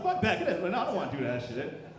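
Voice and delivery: normal talking voice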